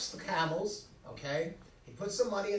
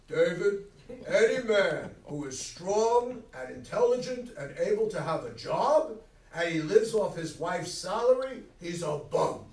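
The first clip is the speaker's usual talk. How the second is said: father laying down the law, louder; old man's verdict; 5 dB louder than usual. Retold or imitated. Father scolding his son, a louder stern lecture. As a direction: growl it like a blunt old dad. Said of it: "My old man".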